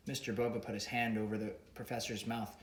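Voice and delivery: usual speaking voice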